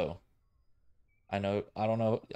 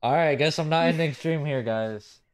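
loud, higher, giving in; exasperated shout; 7 semitones higher, 9 dB louder than usual. Real, not acted. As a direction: throw up your hands and shout it. Giving in to teasing friend.

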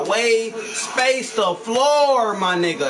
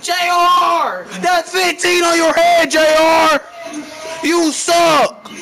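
full shout, much higher and louder throughout; furious rage; 5 semitones higher, 5 dB louder than usual. Real, not acted. Gamer raging at teammate J R over bad play, furious shout. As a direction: scream your frustration at a useless teammate. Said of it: "I never raged like that before".

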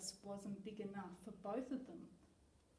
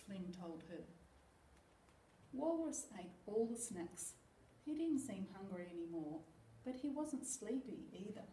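ordinary delivery

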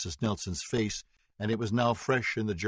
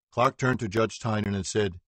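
ordinary delivery, nothing marked

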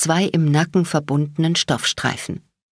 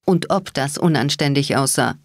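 ordinary delivery

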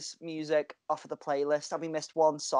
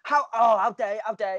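mock outrage; 4 semitones higher, 5 dB louder than usual. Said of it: "he was having a proper go at us".

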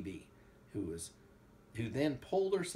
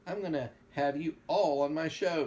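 sing-song call; 5 semitones higher, 7 dB louder than usual. A narrator's reading. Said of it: "sang out".

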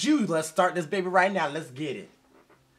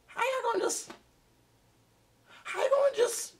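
squeaky high disbelief. Real, not acted. Outraged disbelief at video.

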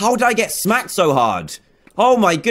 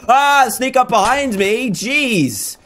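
high, drawn-out startled cry; slow, high-pitched alarm; startled outburst; 8 semitones higher, much slower than usual. Real, not acted. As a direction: yelp it as if ambushed. Ambushed in the game.

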